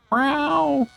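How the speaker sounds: squeaky high meow, falling; high cat meow. Pretend. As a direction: meow like a tiny kitten. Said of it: "Meowing brought out all of the kitties".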